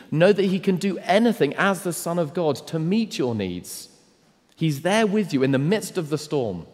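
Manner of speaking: high, loud, driving sermon voice; full-force preaching. Acted. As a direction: preach it hard, building and pressing.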